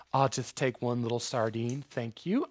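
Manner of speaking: low, very soft little request; mock dainty modesty. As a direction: play a meek eater, barely speaking up. Imagines a polite, modest eater.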